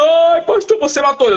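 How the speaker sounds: imitated high-pitched shriek of alarm; alarmed woman's cry. Woman crying out as the man drops stiff, alarmed retold cry. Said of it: "Aí a mulher gritou".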